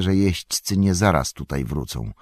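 usual speaking voice

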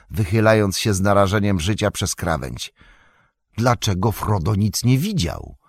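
ordinary delivery, nothing marked

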